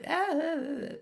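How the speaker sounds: put-on very high squealing toddler cries; high playful kid squeals; imitating toddlers. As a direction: mimic two giggling toddlers squealing. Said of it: "they're like".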